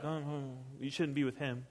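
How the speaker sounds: very soft, muttered objection; disapproving murmur. Acted. Onlookers objecting as Jesus goes with outcasts, soft murmur. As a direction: murmur disapproval under your breath. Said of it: "the people are like".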